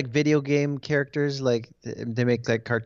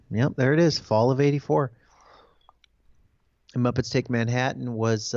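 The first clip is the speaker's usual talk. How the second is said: ordinary talk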